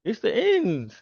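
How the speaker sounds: high-pitched mock despair; hammed-up high wail of mock alarm; mock Twitter panic. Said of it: "those people on Twitter".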